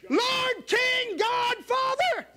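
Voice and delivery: high, loud fiery preaching; preaching at full force. Acted. Preacher stirring up the church, loud and high. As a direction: shout it out to the whole church. Preacher voicing Paul, climaxing.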